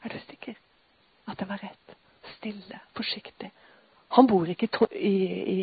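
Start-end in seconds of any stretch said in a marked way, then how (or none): 0.0-2.0 unvoiced gentle whisper, much softer; God whispering. God asking his child softly, in a whisper. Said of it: "Nå begynner jeg å hviske". 2.4-3.5 soft unvoiced whisper. Real, not acted. Preacher describing God's quiet way, whispering. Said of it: "Nå begynner jeg å hviske".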